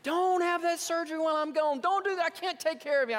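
high, loud nagging imitation; imitated wife. Wife warning husband against surgery, imitated scolding. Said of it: "she was like".

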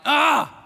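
loud high scornful "Ah!"; critic's scornful cry. Shown as a demonstration. Preacher imitating the unkind critic, loud scornful cry. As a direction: bark out a disgusted "Ah!". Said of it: "the unkind critic would go something like".

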